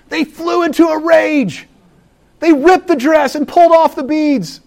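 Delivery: raging stepsisters. Acted. Narrating stepsisters' rage.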